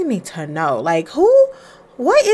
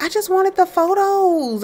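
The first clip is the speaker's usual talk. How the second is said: pitched-up wistful wife; high wife voice; 11 semitones higher than usual. Acted. Wife telling husband she wanted photos, high wistful voice. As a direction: voice the wife up high, a little let down.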